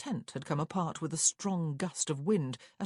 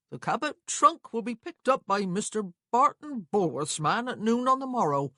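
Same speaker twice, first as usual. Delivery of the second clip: higher, louder country clerk voice; officious clerk; 6 semitones higher, 7 dB louder than usual. Acted. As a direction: read it off the paper as a self-important clerk.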